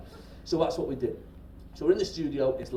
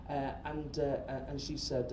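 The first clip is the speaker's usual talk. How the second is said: normal talking voice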